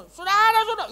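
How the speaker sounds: high, loud preacher yell; hammed-up pulpit holler; straining shout. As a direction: imitate preaching till the veins pop. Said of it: "Itu urat sampai keluar".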